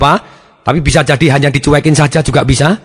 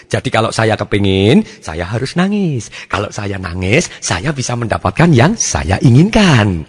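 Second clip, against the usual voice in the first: put-on slow, lower voice of a child working it out; dawning realisation; 5 semitones lower, slower than usual. Child learning that crying gets results, slow realisation. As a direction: think it through aloud, drawn out.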